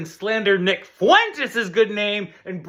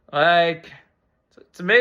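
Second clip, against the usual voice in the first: ordinary delivery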